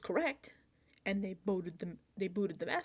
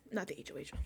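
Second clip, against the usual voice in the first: softer, lower mumbled slip; slip of the tongue; 6 semitones lower, 7 dB quieter than usual. Real, not acted. Host misspeaks while recapping the vote, quiet slip. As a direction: let the wrong phrase slip out quietly. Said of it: "not the accomplice".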